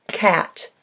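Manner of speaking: usual speaking voice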